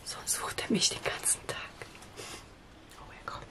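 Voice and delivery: hushed whisper while hiding. Real, not acted. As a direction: whisper so the seeker can't hear. Hiding from child during hide-and-seek.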